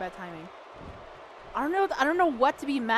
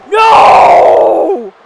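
huge, high, long "no"; explosive cry of protest; anguished shout; 5 semitones higher, 18 dB louder than usual. Real, not acted. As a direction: bellow it in disbelief. Pin count fails.

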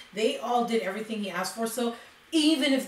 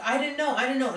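raised, pleading I didn't know; imitated distraught denial; 5 semitones higher, 4 dB louder, faster than usual. Witness denying to prosecutor, mildly distraught. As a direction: mimic a witness near tears, insisting. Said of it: "she would break down crying".

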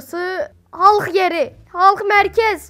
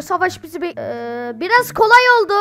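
ordinary talk

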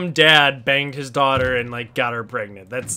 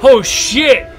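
excited yelling; 7 semitones higher, 4 dB louder, slower than usual. Real, not acted. Mind blown by twist.

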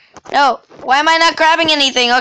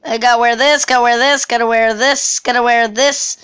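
ordinary delivery

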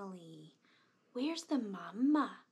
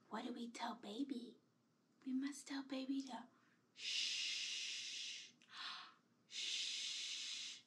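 whispering; 9 dB quieter than usual. Pretend. Hushing the baby doll.

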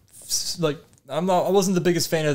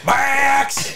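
calling out; 11 semitones higher than usual. Pretend. Calling out a name.

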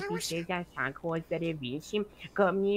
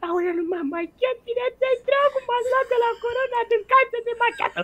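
high, loud fake wail about no makeup; mock dismay; 5 dB louder than usual. Friend on voice chat, fake wailing about makeup, high and loud. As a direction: shriek it like a diva caught without makeup.

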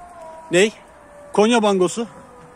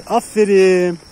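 loud drawn-out well done; praising the bird; 3 semitones higher, 7 dB louder, much slower than usual. Real, not acted. Keeper loudly praising the pigeon that landed. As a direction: cheer the pigeon as it lands.

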